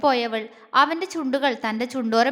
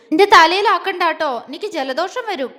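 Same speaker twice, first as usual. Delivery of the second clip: louder, earnest warning; serious warning; 4 semitones higher, 7 dB louder than usual. Acted. Jwala warns Agni not to splash her head, serious. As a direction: warn him off, mock serious. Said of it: "അവൾ ഗൗരവത്തോടെ പറഞ്ഞു".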